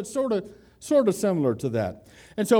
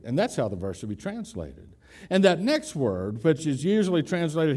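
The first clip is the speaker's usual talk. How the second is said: ordinary delivery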